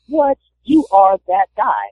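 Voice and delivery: high, slow, amazed "what"; mock disbelief. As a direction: gasp it out like you can't believe it. Shock at idol sitting beside him.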